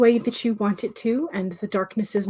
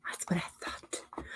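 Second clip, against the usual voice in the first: much softer, slower whispered echo; fainter whisper; 17 dB quieter, slower than usual. Real, not acted. Streamer echoing her own remark, fainter slower whisper. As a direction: let it fade to a faint slow whisper.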